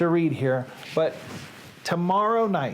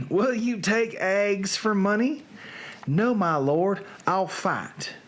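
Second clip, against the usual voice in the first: put-on Southern accent. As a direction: recite it theatrically in a Southern accent. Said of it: "read now in a Southern accent".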